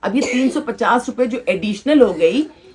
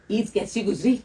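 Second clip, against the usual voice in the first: ordinary talk, nothing marked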